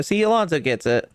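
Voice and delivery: ordinary talk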